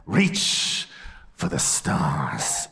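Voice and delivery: slow breathy hush on the stars; mock dramatic whisper. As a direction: whisper it like a big secret.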